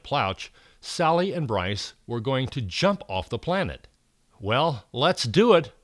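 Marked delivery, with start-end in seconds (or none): 4.4-5.7 slow, louder nervous resolve. Acted. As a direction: steel yourself, nervously. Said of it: "Bryce said nervously".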